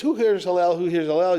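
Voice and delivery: a pest shouting at the sage's door; obnoxious taunt. Retold or imitated. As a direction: needle him with the name. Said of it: "obnoxiously goes".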